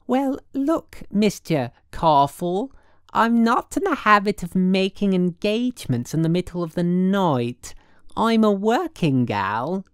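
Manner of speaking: high, loud, drawn-out woman's voice; affected city woman, indignant. A narrator's reading. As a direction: put the caller in his place with airs.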